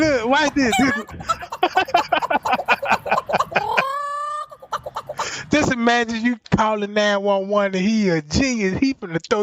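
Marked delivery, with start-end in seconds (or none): 1.0-4.4 high-pitched helpless laughter; cracking up at his own joke; long high laugh. Real, not acted.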